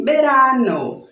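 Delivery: verano said like berano, lips closed for the V. Shown as a demonstration. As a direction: verano with the B sound. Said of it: "falam com som de B".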